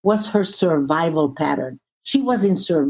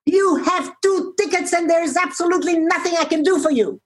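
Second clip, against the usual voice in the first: imitating a shout; 9 semitones higher than usual. Airline agent turning away a traveller, imitated shout. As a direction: mimic someone shouting, pitched well up. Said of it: "she shouts at me".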